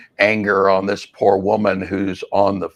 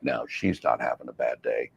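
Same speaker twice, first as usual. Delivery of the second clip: dry denial; 10 semitones lower, 12 dB quieter than usual. Retold or imitated. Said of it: "and I'm like".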